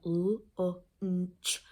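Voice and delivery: l, o, n, ch as separate phonemes; sounding out. Shown as a demonstration. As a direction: sound out "l, o, n, ch" one by one. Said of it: "we have to sound out".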